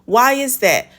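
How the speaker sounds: usual speaking voice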